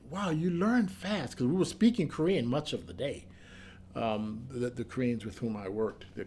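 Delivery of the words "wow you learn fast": impressed wow. Retold or imitated. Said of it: "he said".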